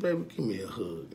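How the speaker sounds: soft, low, gentle ask for a hug; tender request. Retold or imitated. Father softly asking his daughter for a hug, tender. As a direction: coax her into a hug, softly.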